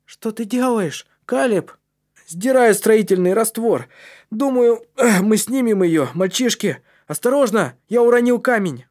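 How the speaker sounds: high, loud, strained shouts; urgent calling out. A narrator's reading. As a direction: call to your mates while straining at work. Мальчики перекликаются за работой в трубе.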